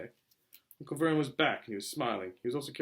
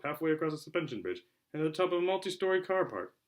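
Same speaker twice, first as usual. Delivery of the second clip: ordinary delivery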